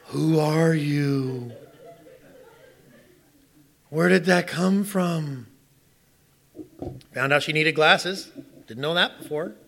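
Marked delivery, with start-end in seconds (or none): 0.1-5.5 slow, stunned, disbelieving question. Retold or imitated. Stunned husband reaction.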